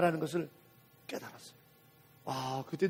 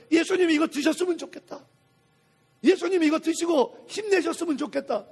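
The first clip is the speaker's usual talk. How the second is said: put-on understated eager child, raised pitch and volume; eager child's wish; 7 semitones higher, 5 dB louder than usual. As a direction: voice a child's earnest wish, higher and louder. Voicing the single-minded child's wish.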